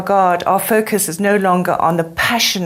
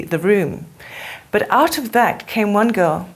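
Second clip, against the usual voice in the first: normal talking voice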